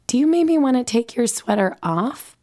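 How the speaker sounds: put-on child's voice, gently sagely suggesting; sage counsel. A narrator's reading. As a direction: play a small boy being wise beyond his years. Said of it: "Ben counsels sagely".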